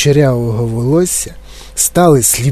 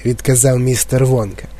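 ordinary delivery, nothing marked

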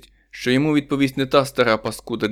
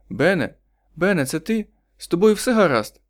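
higher, anxious questions; worried wife; 7 semitones higher than usual. A narrator's reading. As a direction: ask fearing the worst, higher. (стривожена дружина, вищим голосом)

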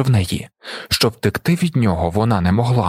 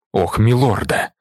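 understated dismayed exclamation; a touch of anguish, without raising the voice; anguished cry. A narrator's reading. Stilgar sees his friend's burnt eyes, mildly anguished. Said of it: "зойкнув Стілгар".

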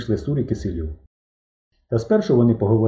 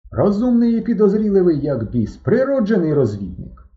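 character voice; 9 semitones higher, 3 dB louder than usual. Acted. Guitarist praises the scout.

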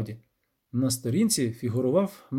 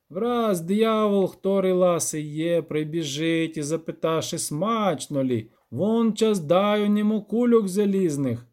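higher, measured archaic recitation; lightly sing-song granny reader; old granny reading aloud; 5 semitones higher, slower than usual. Acted. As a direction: recite an old book with drawn-out phrases.

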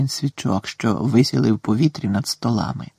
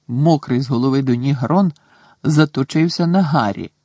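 ordinary delivery, nothing marked

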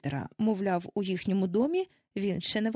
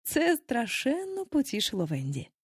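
usual speaking voice

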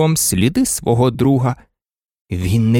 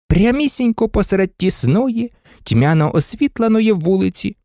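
ordinary delivery, nothing marked

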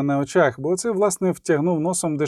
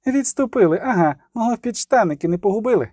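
high, quick sneering jab; mocking; 6 semitones higher than usual. A narrator's reading. Ciri mocks the imperial army, fast and high. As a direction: rattle off the taunt.

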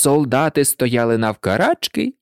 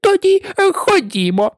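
high-pitched commanding cry; raised, shrill royal order; bawling queen. A narrator's reading. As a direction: call them along imperiously. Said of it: "загорланила королева".

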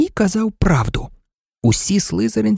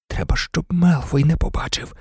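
ordinary delivery, nothing marked

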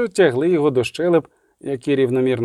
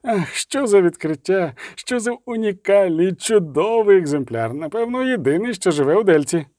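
gushing scholar; 6 semitones higher than usual. Acted. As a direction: marvel aloud at the specimen.

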